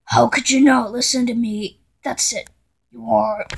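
usual speaking voice

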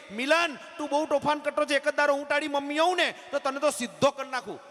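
high-pitched mock scolding, lightly shouted; angry teacher. Teacher scolding naughty Milan, high mock angry shout. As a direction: play the fed-up teacher telling off a pupil. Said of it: "એની madam એના પર ગરમ થઈ ગઈ".

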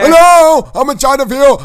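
high, loud hello from inside, easing down; calling for help from inside a chicken; calling out trapped. Acted. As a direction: shout to be found from somewhere stuck.

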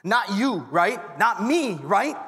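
preacher calling out to the congregation; preaching. Acted. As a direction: punch each short line to the crowd. Preaching rhythm.

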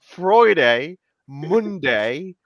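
laughing, slow "Saturday" in a hammed-up British accent; laughing at a colleague's accent, mock British. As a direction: tease the weekday names in a put-on London voice. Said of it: "That's what he say".

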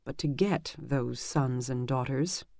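plain reading voice

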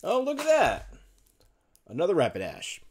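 ordinary talk, nothing marked

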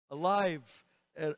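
ordinary delivery

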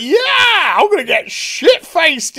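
high, loud party cheer; excited whoop. Acted. Heroine agreeing to a drink, excited whoop. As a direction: whoop it, keen for a big night.

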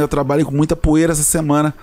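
normal talking voice

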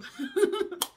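high, loud burst of giggles; real laugh. Real, not acted. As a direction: laugh out at yourself. Laughs at own hand-washing claim.

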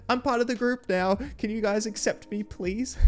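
high-pitched mock teen plea; imitating pleading teenager. Teenager asking the group to accept him, mock pleading. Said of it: "just being a normal teenager, being like".

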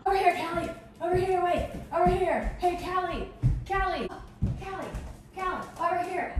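high excited photographer shouts; paparazzi calling. Pretend. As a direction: call for her attention. Playing paparazzi calling to star.

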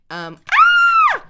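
sudden high shriek; startled scream. Real, not acted. Gamer startled by a zombie, screams. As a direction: scream in fright.

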